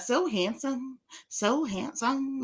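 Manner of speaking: softly drawn-out mimicry, very slow; imitating her voice. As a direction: draw it out quietly like you're mimicking her. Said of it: "always have to do her voice".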